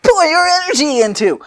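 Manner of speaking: high eager explaining. Acted. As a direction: pitch it up like the keen inventor. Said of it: "Fu starts to explain".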